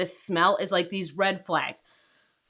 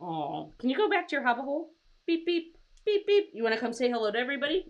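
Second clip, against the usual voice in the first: pet talk; 4 semitones higher, 4 dB quieter, faster than usual. Real, not acted. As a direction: coo at the little animal, sweet and light. Talking to her pet.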